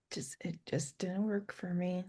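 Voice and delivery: hushed unvoiced aside; whisper. Real, not acted. Fan whispers her dislike of the sequel to friend. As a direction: breathe it out as a confession.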